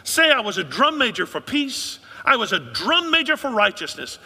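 loud, high oratory in King's cadence; preaching King quote. Retold or imitated. Pastor quoting King to the church, loud high oratory. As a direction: ring it out like a civil rights sermon. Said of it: "Martin Luther King Junior said".

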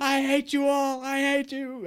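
very high, loud mock wail; drawn-out, hammed-up tearful scream; Jon Jones crying meltdown. Friend acting out the fighter's arrest meltdown, wailing mock scream. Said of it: "he's crying".

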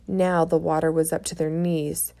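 plain reading voice

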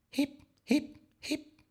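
tiny high hiccup squeaks; imitated hiccups. A narrator's reading. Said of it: "le entró hipo". (hipo agudo)

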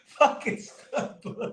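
breathy laughing repeat; laughing curse. Real, not acted. Friend repeats curse at Stud Buds, laughing. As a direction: spit it out through laughter.

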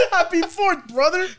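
high, loud bro toast through laughter; laughing party bro imitation. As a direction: echo the bro greeting while cracking up. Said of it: "he looked at me, he's like".

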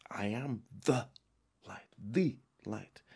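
"light" drawn out slowly, each word weighted; emphatic. Shown as a demonstration. Preacher stressing Jesus is "the light", emphatic. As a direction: land hard on "light" to claim it. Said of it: "It's emphatic".

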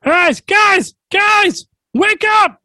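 very high, loud squeaky yell; squeaky frog shout. Acted. The frog yelling at the sleeping heroes, high shout. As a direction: shriek at them to wake up. Frog shaking them awake.

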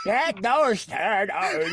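very slow, drawn-out cartoon villain; Krang. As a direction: imitate a cartoon villain, relishing each word. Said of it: "You sound like Krang".

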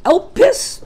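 elpis, said slowly with a rising lift; Greek word. Shown as a demonstration. Preacher teaching the congregation the Greek word elpis, rising. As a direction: sound out elpis, stress EL, rising at the end. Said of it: "the Greek word for hope is".

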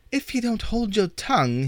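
shrieking. Acted. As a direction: shriek the threat at the troll. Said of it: "he shrieked to the troll".

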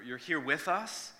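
usual speaking voice

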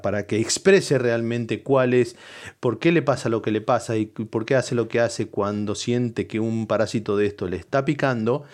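ordinary talk, nothing marked

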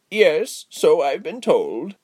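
drawn-out, high character voice; amused old lady. Acted. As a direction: answer slowly in an old dame's voice. Old lady answers excited visitor.

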